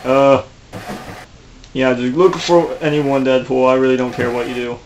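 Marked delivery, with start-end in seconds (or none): none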